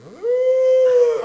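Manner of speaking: high loud ooh; cow's cry. Retold or imitated. Preacher imitating the mother cow in labor, high loud cry. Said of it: "Mama's going".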